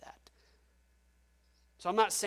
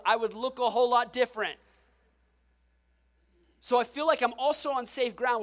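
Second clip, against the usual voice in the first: raised, higher preacher's voice driving the point home; preaching at full force; 4 semitones higher, 5 dB louder than usual. Acted. Pastor pressing the congregation on God's grace, forceful preaching. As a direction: build it up like a pulpit climax.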